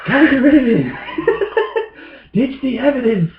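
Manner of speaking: hammed-up frantic panic, laughing at himself; mock panic. Reliving flushing the stash at midnight, mock frantic high voice with laughter.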